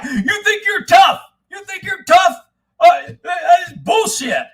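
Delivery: high, loud, slow taunting yell; mock angry shout. Sheriff taunting him in the shower, mock angry shout. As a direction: bellow a challenge at him.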